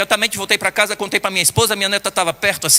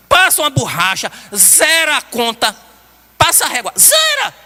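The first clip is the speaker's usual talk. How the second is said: much higher, emphatic demand; urgent insistence; 6 semitones higher than usual. Retold or imitated. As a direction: push hard to wipe it clean. Angel urging to erase humanity.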